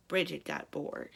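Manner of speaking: ordinary delivery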